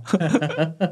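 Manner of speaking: rising giddy laugh; burst of laughter. Real, not acted. Laughs at own wish granted.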